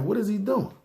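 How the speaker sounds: high, hushed baffled question; high-pitched private bewilderment, retold; puzzled. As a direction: wonder what on earth he's up to. Puzzled inner reaction to being called first.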